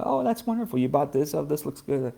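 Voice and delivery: wife's high cheerful welcome of the shopping; sweet high wife voice. Retold or imitated. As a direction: imitate her warm delight way up high. Voicing wife's kind welcome.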